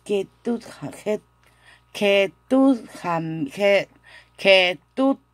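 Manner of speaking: halting restarts on a hard word; struggling pronunciation. Real, not acted. Teacher stumbles over a hard Korean word, halting. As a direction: keep breaking off ketutham- and trying again. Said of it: "es difícil esta pronunciación hasta para mí".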